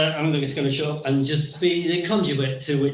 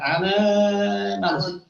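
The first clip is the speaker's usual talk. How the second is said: announcer voice; 6 semitones higher, much slower than usual. Acted. Host announces the first speaker.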